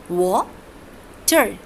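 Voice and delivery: water said slowly, wa then ter; syllable split water. Shown as a demonstration. Teacher splitting water into wa and ter for the class. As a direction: stretch water into its two syllables. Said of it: "wa is one syllable and ter is second syllable".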